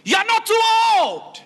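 preacher exhorting the congregation at full force; preaching shout. Real, not acted. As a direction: cry it out over the crowd.

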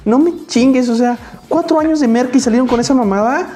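high, indignant mock rant; mock outrage at bad ads; exasperated rant. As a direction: rant at the TV in disbelief. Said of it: "tú dices".

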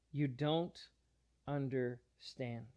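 slow the words right down, letting "understand" fall soft. Retold or imitated. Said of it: "Jesus pushes back".

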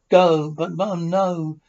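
put-on loud, slow, pleading child's no; whiny protest. Child pleading with Mum not to be sent away, loud and whiny. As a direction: beg not to be sent to the room.

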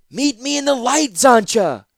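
high, loud call. Acted. Ratapi calling to Zancha across the spindle, high and loud. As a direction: call her across the light.

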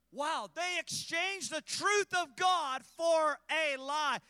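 loud, high, slow, hammering each word; preaching at full force. Acted. Preacher warning the congregation, loud and high. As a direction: thunder it from the pulpit.

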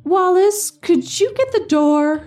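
high voice calling across the house; wife calling out. Acted. Great-grandmother calling to Wallace to get the door, high. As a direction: call up high to your husband. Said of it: "she called to my great-grandpa".

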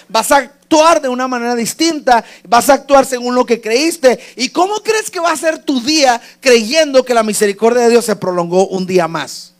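loud, high preacher's delivery; impassioned preaching. Real, not acted. Preaches at full force.

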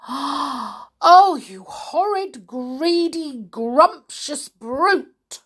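high, loud, slow indignation; aghast scolding, pitched right up; outraged cry. Acted. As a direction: cry each insult out in outrage. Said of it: "cried Humpy Rumpy".

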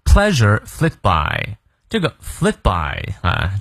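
each word drawn out slowly; slow model reading. Shown as a demonstration. Teacher slowing down to explain each word. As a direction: lay out the phrase slowly for learners. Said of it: "我们来逐词看一下啊".

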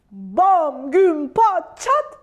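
loud, punched-out sound words; big storybook bangs; booming crash noises. A narrator's reading. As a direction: hit each crash word hard, separately. Crash noises of the landing ambulance.

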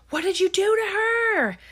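very high, acted-out accusing yell; high-pitched retold outcry; mock outraged cry. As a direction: imitate a horrified shriek of accusation. Acted outraged demand in retold film.

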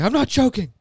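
high, loud insistence; loud high protest. Acted. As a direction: yell it back high. Devlin protests again.

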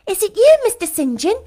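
high, loud call through the door; cried out. A narrator's reading. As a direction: call out in eager relief. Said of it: "cried Hannah".

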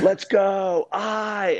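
high, drawn-out holler imitating the guys; calling out. Workout guys rallying each other in the rain, imitated holler.

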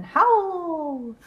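high, loud, long howl; wolf howl. A narrator's reading.

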